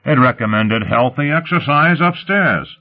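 high, boastful crow. A narrator's reading. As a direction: crow over the good news. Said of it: "Lot crowed".

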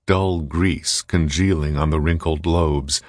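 ordinary delivery

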